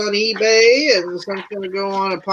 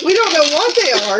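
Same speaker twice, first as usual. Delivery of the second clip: ordinary talk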